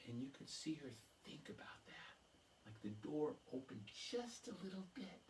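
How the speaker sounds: soft, unvoiced storyteller whisper; hushed whisper. Storyteller telling the crowd of Emily's hesitation in a whisper. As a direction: draw the audience in with a hush. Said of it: "unvoiced hush".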